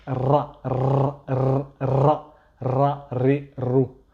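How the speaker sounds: "Ra" with a trilled tongue-tip r; "ra, ré, ro" with a strongly vibrating rolled r. Shown as a demonstration. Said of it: "elle vibre dans, dans la bouche".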